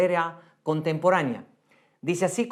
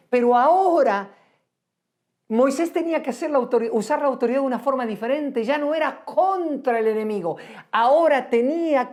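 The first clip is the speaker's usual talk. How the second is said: preaching; 5 semitones higher than usual. Acted. Preaching climax rises.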